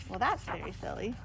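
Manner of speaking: ordinary delivery, nothing marked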